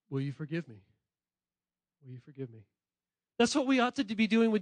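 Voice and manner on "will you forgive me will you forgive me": soft plea. Shown as a demonstration. Modeling asking forgiveness.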